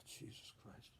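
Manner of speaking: whispered in shock; stunned whisper. Retold or imitated. Patient reacts to the cancer news, a shocked whisper. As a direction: breathe it out in a whisper.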